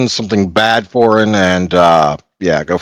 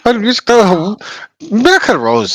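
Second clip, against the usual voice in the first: put-on Indian accent cab driver; 12 semitones higher than usual. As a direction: greet the passenger brightly in an Indian accent. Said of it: "do an Indian accent".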